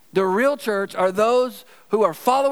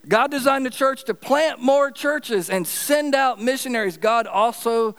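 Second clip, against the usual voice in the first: preaching; 6 semitones higher than usual. Acted. Preaching at a raised pitch.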